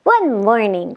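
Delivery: plain reading voice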